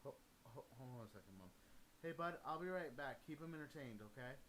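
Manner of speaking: off mic, far from microphone; turned away from the mic; hand over the phone. Real, not acted. Talks to Mom away from mic.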